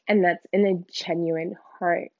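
ordinary talk, nothing marked

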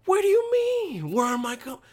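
incredulous comeback. Acted. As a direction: squeal back at a stupid question, acted. Incredulous comeback to stupid question.

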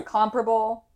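COMPARABLE as KOM-pruh-bul, stressed on the first syllable. Shown as a demonstration. Offering both versions for the edit, "Comparable" stressed up front. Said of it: "I'll say both".

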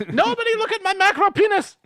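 panicked shout. Acted. Panicked cry covering himself.

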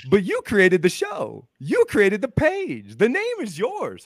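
high, slow, drawn-out sarcastic indignation; mock outrage. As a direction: throw their claim back, stretching it out. Mock outrage at the other party.

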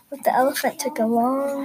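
ordinary delivery

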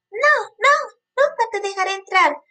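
high, scared piglet squeal; frightened little pig. A narrator's reading. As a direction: cry out in fright, high-pitched. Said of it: "se despertó asustado y respondió".

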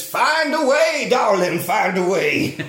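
high, slow, drawn-out command; insistent Dennis Hopper. Retold or imitated. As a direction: push it loud and high, stretching the words. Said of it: "And he says".

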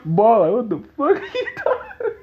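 high laughing yell. Real, not acted. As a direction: shout it through laughter. Laughing in disbelief.

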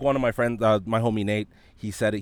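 normal talking voice

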